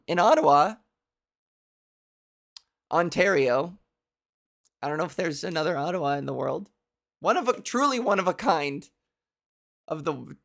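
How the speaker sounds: usual speaking voice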